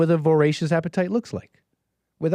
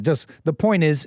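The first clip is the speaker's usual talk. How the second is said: usual speaking voice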